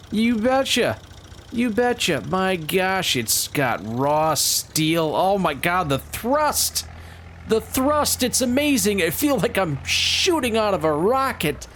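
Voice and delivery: squealing high, hammed-up rocket-ride glee; mock thrill. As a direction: overplay the thrill of a slow tractor. Mock thrill riding slow tractor.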